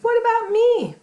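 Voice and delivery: high loud frog voice; squeaky frog. Acted. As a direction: pipe up like a tiny eager frog. Another frog speaks up.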